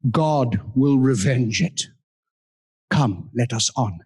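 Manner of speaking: slow, grave Shakespearean verse as Richard the Third. Acted. Richard to Buckingham, a slow, grave Shakespearean verse. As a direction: declaim it like a stage king, drawing it out. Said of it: "And he says to him".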